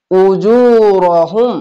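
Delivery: very slow chanted tajweed reading; drawn-out Quranic chant; slow recitation. Shown as a demonstration. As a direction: stretch the long vowels in prayer style. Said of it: "এক আলিফ টেনে পড়বেন".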